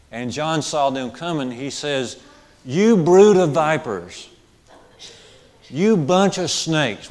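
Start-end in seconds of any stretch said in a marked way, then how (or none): 2.7-7.1 loud, slow denunciation; fiery rebuke. Retold or imitated. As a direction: thunder it like a prophet. John denouncing Pharisees, preached.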